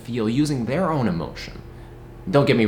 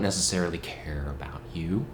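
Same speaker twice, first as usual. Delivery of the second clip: whisper; 8 semitones lower, 9 dB quieter than usual. Real, not acted. Confiding a secret to viewers.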